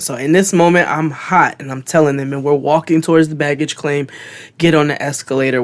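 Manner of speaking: usual speaking voice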